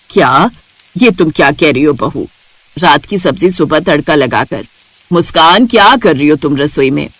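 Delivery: can't believe the bride did this; shocked mother-in-law. Acted. (हैरान सास की भारी आवाज़)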